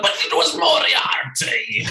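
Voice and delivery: high, drawn-out mock villain reveal; hammed-up unmasking, stretching the name; Moriarty. Cast member fakes unmasking in intros, hammed-up villain cry.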